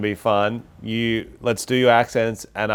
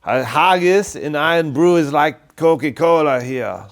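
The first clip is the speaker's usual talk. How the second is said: mock Scottish accent; 2 semitones higher, slower than usual. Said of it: "I'm from Scotland".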